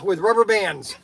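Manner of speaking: loud, punchy sales pitch. Acted. Shop owner pitching his toy guns in an upbeat ad read. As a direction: sell it like a radio spot.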